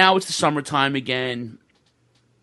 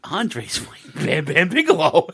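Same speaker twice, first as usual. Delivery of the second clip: laughing reveal; 2 semitones higher, 4 dB louder than usual. Real, not acted.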